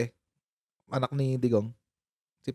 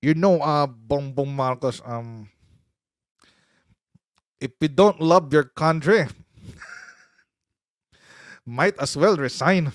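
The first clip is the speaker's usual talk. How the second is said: imitating Baste; 2 semitones lower, slower than usual. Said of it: "mahirap gayahin si Baste".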